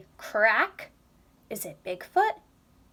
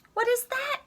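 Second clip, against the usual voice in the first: startled question; 13 semitones higher than usual. Acted. Pete startled by night noise.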